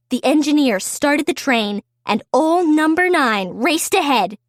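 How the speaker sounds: plain reading voice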